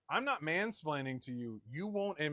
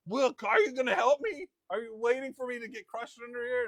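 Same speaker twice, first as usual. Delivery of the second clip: imitating wife, much higher and louder, angry demand for help; mock angry demand; 9 semitones higher, 9 dB louder than usual. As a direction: hurl the question like an accusation. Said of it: "and then it's angry".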